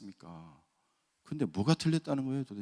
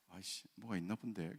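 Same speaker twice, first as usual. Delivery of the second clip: annoyed mutter; 4 semitones lower, 14 dB quieter than usual. Retold or imitated. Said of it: "그러면서".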